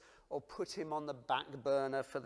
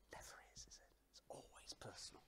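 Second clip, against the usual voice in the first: quick hushed whisper; 17 dB quieter, faster than usual. Acted. Preacher answering the mob, whispered. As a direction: lean in and breathe it.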